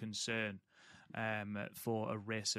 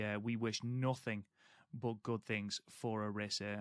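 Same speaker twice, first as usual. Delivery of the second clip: usual speaking voice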